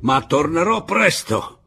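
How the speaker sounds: high, loud, drawn-out warning; taunting promise. Acted. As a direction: stretch out the parting jibe. Pablo's taunting parting promise.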